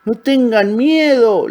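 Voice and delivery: high, drawn-out shout. A narrator's reading. Old crocodile to frightened little ones, shouting. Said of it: "les gritó".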